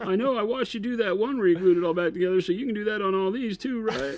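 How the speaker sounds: high, comic politician impression; Bill Clinton. Co-host playing Chris asking for repairs, in Bill Clinton voice. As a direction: keep the put-on high voice, breezily asking a favour. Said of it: "gave Chris the Bill Clinton voice".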